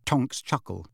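plain reading voice

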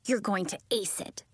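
ordinary delivery, nothing marked

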